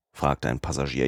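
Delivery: plain reading voice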